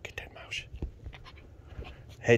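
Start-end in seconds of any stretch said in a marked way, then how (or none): 0.0-0.6 whisper. Real, not acted. Whispered teasing.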